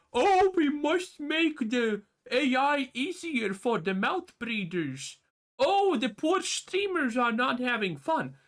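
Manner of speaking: high, slow mocking whine; mocking whiny voice. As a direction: sneer it like a pushover dev. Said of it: "mocking devs caving to streamers".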